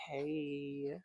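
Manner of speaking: put-on high, soft drawn-out Valley girl greeting; imitated Valley girl drawl. Co-host showing friend how she prolongs words, mock Valley girl. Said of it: "you prolong your words".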